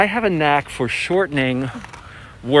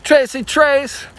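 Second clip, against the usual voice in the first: high-pitched playful call; acted casual catchphrase; 10 semitones higher, slower than usual. Showing how people pad short phrases, playful high call. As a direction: toss it out high, stretching the nickname. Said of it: "they might say".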